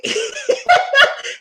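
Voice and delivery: loud, very high laugh; high-pitched loud cackle. Real, not acted. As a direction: burst out laughing. Laughs at viewers' imagined complaint.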